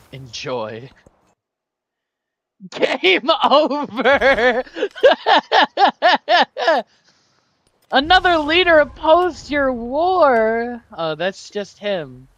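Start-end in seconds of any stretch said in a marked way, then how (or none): 2.7-6.8 laughing mock doom. Gloating at loss. 7.9-10.8 mock game announcer.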